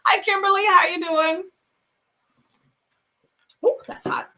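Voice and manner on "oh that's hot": burned by a sip of hot tea; a hot sip, then a quick unvoiced reaction; sipping hot tea. Real, not acted. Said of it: "That's a bit hot".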